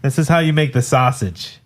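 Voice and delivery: put-on a quick, half-hearted stab at a Chicago accent. Asked by his co-hosts to say the sausage line, a light Chicago accent. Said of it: "that's the Chicago accent".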